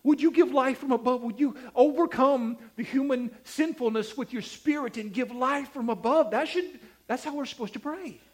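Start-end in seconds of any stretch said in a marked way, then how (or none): 0.0-6.3 high, loud, pleading model prayer; fervent prayer. Shown as a demonstration. Preacher showing how to ask God to give life from above, fervent and raised. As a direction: call on God with mounting urgency. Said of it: "We pray".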